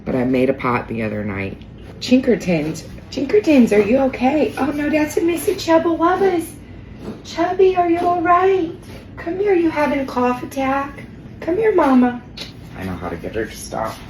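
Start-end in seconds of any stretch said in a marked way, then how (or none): 2.0-12.2 very high-pitched doting coo. Real, not acted. Owner checking on coughing pet, baby talk. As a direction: fuss over a pet in a tiny high voice.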